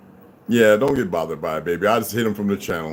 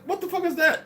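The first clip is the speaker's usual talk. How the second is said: surprised. Retold or imitated. Quoting his own reaction.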